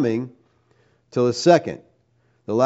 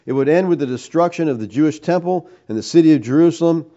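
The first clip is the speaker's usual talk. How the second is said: ordinary talk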